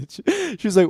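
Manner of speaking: ordinary talk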